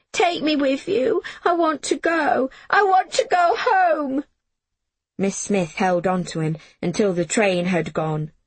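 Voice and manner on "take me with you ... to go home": shouting plea. Acted. Jamie shouting at Billy to take him home. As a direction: scream to be taken along. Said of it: "he shouted".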